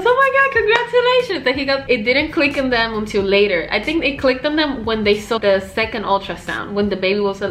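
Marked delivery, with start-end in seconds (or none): 0.0-1.4 high-pitched imitation of a thrilled friend; excited congratulations. Friend congratulating on the pregnancy, mock excited squeal. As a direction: act out delighted congratulations, pitch way up.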